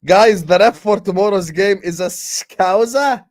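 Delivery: loud slow reveal that the ref is a Scouser; excited announcement. Real, not acted. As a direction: break the news to the chat, big and slow. Announcing surprising news to chat.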